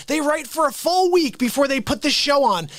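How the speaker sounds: ordinary talk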